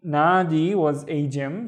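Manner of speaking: ordinary talk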